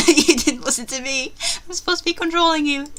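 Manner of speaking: loud, high laughing complaint; laughing scold. Real, not acted. Player laughing at her own character, teasing. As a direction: tease the character through laughter.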